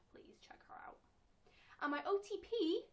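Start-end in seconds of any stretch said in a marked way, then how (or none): 0.1-1.0 unvoiced urging whisper. Real, not acted. Fan urging viewers to check out the video maker. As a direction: whisper the recommendation.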